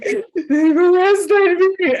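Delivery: high, loud, slow breaking cry; calling mom from the roadside, crying out high and slow; tearful wail. Retold or imitated. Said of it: "I break down".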